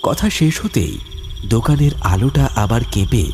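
plain reading voice

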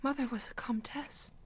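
breathless whisper of disbelief; stunned whisper. Acted. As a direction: barely voice it, shocked. Daughter stunned by revelation.

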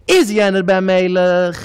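loud, drawn-out indignant cry; slow, high-volume challenge, outraged; indignant outburst. Acted. Storyteller acting out a character's protest, indignant shout.

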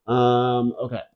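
ordinary talk, nothing marked

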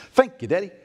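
put-on high, loud little-kid thanks; little daughter. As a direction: imitate a small child thanking Dad. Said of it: "She goes".

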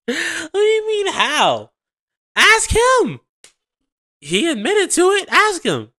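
high, loud squealing mock; shrill mock rant. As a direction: shriek it like the angry girlfriend. Mocking the girlfriend, shrieking.

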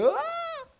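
hoher erschrockener Aufschrei; frightened shepherd cry. Retold or imitated.

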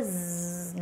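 z drawn out like a bee buzzing; soft, long bee-like z. Shown as a demonstration. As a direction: hold a voiced, buzzing z. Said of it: "cái tiếng con ong nó kêu".

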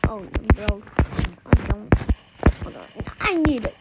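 ordinary talk

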